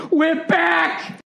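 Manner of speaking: high mock-ecstatic shout; imitating flipping out. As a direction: cry it out like an overjoyed owner. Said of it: "he flipped out".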